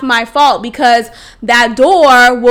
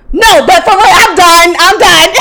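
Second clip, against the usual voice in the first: higher and louder, laughing as she says it; laughing declaration; 5 semitones higher, 5 dB louder than usual. Real, not acted. Swearing off the ex to her listeners, laughing. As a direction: insist you're done, cracking up.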